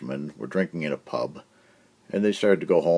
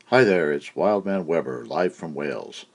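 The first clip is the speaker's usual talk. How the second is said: ordinary talk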